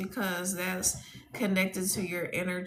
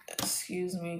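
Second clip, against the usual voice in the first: ordinary talk, nothing marked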